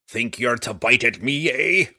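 dwarf taunting the wounded worm; dwarf crying out. Acted. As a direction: jeer at the beast as you hit it. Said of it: "the dwarf cried".

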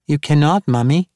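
put-on small girl's voice, pitched well up; tiny child voice. A narrator's reading. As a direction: sing it out like a tiny eerie child. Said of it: "in her tiny voice".

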